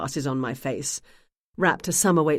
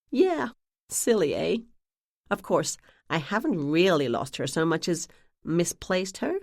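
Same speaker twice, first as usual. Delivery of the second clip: put-on New England accent. A narrator's reading. Narrator keeps up the New England accent with the clerk. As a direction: play the ditzy sister in a New England accent. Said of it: "in my best New England accent".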